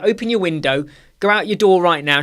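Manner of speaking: usual speaking voice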